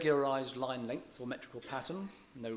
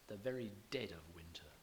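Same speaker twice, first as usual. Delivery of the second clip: whispered verse; 3 semitones lower, 7 dB quieter than usual. Shown as a demonstration. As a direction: breathe the line, plodding. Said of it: "plodding dullness".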